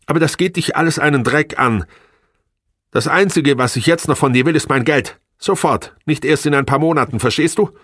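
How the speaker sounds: high, loud angry demand; losing control. A narrator's reading. Wife demanding her money from husband, temper gone. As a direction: lose your temper and demand, high-pitched. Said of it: "Ihre Beherrschung war dahin".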